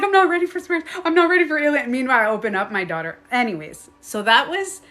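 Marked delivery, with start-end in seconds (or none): 0.0-1.9 high, louder refusal; mock panic. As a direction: back away from the unknown.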